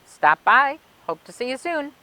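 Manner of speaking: ordinary delivery, nothing marked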